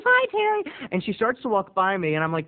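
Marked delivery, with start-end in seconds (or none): 0.0-0.9 put-on high girly voice. Girl's breezy reply.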